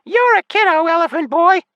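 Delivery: very high, loud outraged fairy; shriek. A narrator's reading. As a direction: shriek an insult right back. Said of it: "she shrieked".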